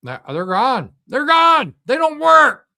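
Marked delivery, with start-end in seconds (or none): 1.1-1.7 "gone" yelled much higher and louder; shouting. Real, not acted. Vlogger yelling to viewers that the missiles are gone, shouting. 1.9-2.7 "gone" yelled much higher and louder; shouting. Real, not acted. Vlogger yelling to viewers that the missiles are gone, shouting.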